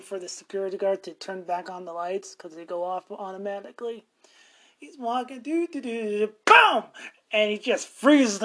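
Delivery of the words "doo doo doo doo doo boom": footsteps then boom. Retold or imitated. Imitating guard's footsteps then clap.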